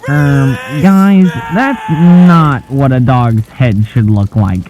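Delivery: loud, startled, freaked-out outburst; suddenly loud and alarmed at the screen; alarmed reaction. Real, not acted. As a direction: yelp it out as the footage turns horrifying. Reacting to creepy dog footage.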